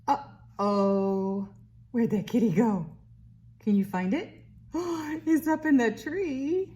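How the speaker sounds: bright sing-song read-aloud voice; reading to a toddler. Acted. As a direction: read it like to a little one. Reads a picture book to baby.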